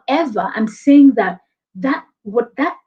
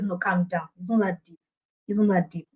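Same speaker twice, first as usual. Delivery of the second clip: much softer, lower dismissal; calming down; 4 semitones lower, 8 dB quieter than usual. Shown as a demonstration. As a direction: bring the energy right down. Calming down the overeager candidate.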